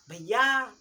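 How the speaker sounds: loud call with भैया drawn out; calling out from afar. Shown as a demonstration. Showing how to call a faraway brother, loud and long. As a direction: shout to someone far away, holding the last vowel. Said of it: "तो आप ऐसे चिल्लाएंगे ना".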